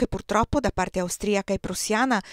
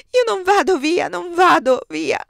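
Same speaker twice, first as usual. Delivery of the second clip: much higher and louder, the last "vado" slowed and falling; desperate crying out; 10 semitones higher, 10 dB louder than usual. Acted. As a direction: cry it out in despair, dragging the final "non vado via". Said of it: "gridava disperata".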